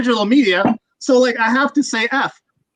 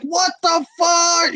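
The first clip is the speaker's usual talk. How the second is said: drawn-out, higher-pitched disbelief; mock-astonished slow exclamation; acted amazement; 6 semitones higher, much slower than usual. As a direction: stretch it out in stunned awe, re-enacting the moment. Both acting out amazed reaction.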